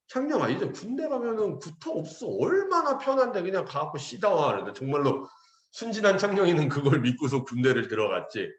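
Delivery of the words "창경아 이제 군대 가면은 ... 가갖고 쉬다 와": higher-pitched, breezy elder's assurance; reassuring elder. Retold or imitated. Elders to youth before enlistment, mock reassuring. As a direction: imitate an elder selling army life as easy. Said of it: "어른들이 막".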